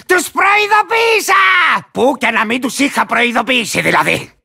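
crazed warrior gloating over trespassers; cantankerous lizard rider. Acted. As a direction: crow that they had it coming.

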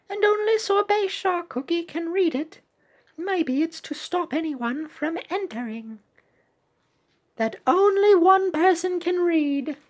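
squeaky eager cartoon sidekick reading game dialogue; high cute shark voice. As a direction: pitch way up for the little shark character. Reads shark character's lines.

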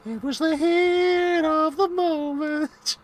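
slow, high put-on voice; silly high voice. Player drawls in a goofy high voice.

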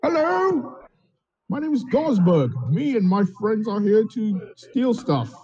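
put-on high, cheery drunken rogue. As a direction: greet them like a happily drunk thief. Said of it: "He's drunk".

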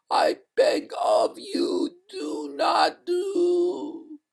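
very high wail, slowing to a halt; freezing ghost. A narrator's reading. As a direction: beg desperately until the words freeze. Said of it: "Here even the words froze".

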